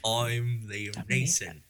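soft, slow, put-on Cockney voice; Michael Caine impression. Another host tries the impression, quiet slow Cockney. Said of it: "That's Michael Caine".

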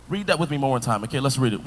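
usual speaking voice

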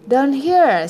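little voice. A narrator's reading. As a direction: call it up in a little voice. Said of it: "said the little voice".

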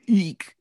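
high-pitched squeak of "eek"; cringing eek. Shown as a demonstration. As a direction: let out a quick, high eek of unease. Cringing reaction to a detail.

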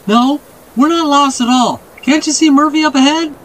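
put-on high, emphatic child voice; insistent boy. A narrator's reading. Boy assures men they aren't lost, insistent. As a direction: protest in a high boyish voice.

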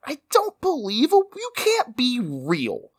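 high-pitched disbelief; incredulous. Acted. Host challenging the jouster guest's story, incredulous.

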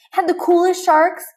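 high, loud and thrilled; excited. Acted. Andy telling his toys about sharks, excited.

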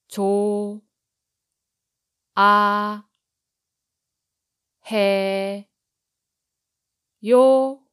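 조, 아, 해, 요 each syllable said separately and loudly; 조 아 해 요 split into four loud separate syllables. Shown as a demonstration. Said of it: "break it into syllables so that you can repeat after me".

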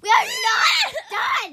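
loud, slow, rising to a high shout; drawn-out squealing objection; shouted protest. Real, not acted. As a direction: stretch it out and yell in protest. Protesting friend's attempt to sign off.

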